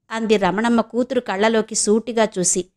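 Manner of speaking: plain reading voice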